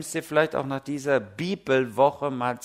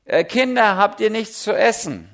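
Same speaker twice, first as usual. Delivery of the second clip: higher, louder call across the water; Jesus calling out; 7 semitones higher, 7 dB louder than usual. Retold or imitated. Jesus calls to the disciples in the boat, loud and high. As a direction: call out to the fishermen from the shore. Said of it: "Spricht Jesus zu ihnen".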